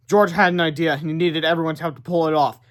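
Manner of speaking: plain reading voice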